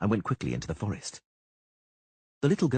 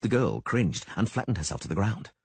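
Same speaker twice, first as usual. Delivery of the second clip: usual speaking voice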